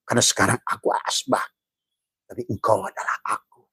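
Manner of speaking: usual speaking voice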